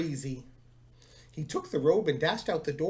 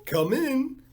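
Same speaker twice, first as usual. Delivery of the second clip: the echo call, less loud, still from far off; softer faraway call; 7 semitones higher, 5 dB louder than usual. Acted. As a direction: let the second one trail nearer. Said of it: "called a faraway voice".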